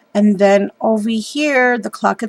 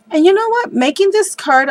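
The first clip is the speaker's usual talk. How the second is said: much higher and louder, bursting with news; excited realization; 10 semitones higher, 4 dB louder than usual. Real, not acted. Crafter telling her viewers a sudden realization, excited and much higher. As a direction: lean in like you just remembered something big.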